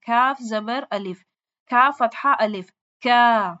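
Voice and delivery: slow spelled-out کاف زبر الف, then کا; spelling drill. Shown as a demonstration. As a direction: spell it out, then join the syllable. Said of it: "الف یہ دیکھیں".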